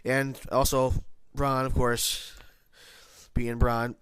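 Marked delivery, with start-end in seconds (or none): none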